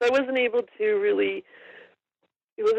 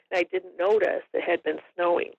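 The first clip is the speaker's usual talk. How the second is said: ordinary talk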